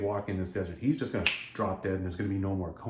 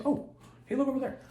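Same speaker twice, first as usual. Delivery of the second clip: excited. Acted. Spotting the well excitedly.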